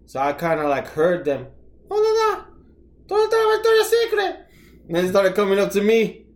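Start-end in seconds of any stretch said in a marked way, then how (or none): 1.9-4.4 high, fast Puerto Rican tourist voice; puerto rican accent. Retold or imitated. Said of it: "did your Puerto Rican accent come out?".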